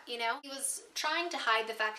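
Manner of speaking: ordinary talk, nothing marked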